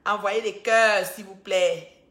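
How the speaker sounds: loud, slow call for hearts; loud teasing plea to send hearts; playful loud. Pretend. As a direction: boom out the request to the viewers. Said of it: "Je parle doucement".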